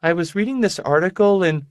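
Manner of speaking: lightly voiced sick young woman, high; airy, distracted girlish voice; high fragile young woman. Rebus voiced by narrator.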